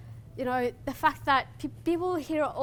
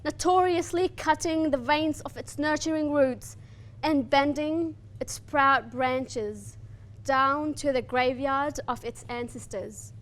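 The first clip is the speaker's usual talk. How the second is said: poem recitation; 2 semitones higher, 5 dB louder than usual. Acted. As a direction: declaim it like a lament for a lost homeland.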